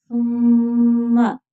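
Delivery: सुम्मा drawn out with a nasal hum on the mm; tajweed demo. Shown as a demonstration. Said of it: "सा बगैर सीटी के".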